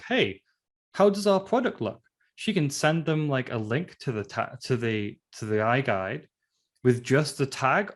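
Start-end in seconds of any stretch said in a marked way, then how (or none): 0.0-2.0 high, bright asking client. Retold or imitated. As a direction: pitch it up like an eager client. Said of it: "the client's like".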